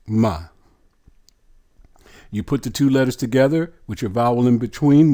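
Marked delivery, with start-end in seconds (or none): 0.1-0.5 "Ma" as one isolated syllable; "Ma" with an open ah; pronunciation demo. Shown as a demonstration. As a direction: sound out "Ma" for learners. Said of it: "How is it pronounced?".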